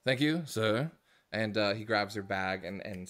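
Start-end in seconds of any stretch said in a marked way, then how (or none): 0.0-0.9 high, loud, eager orc bellhop voice; chirpy old-fashioned bellhop. Acted. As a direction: greet the guests like a chirpy old-time bellhop. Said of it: "He goes".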